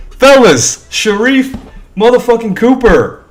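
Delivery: high, loud hype shout; frat bro. Real, not acted. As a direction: hype up the fellas. Celebrating pick.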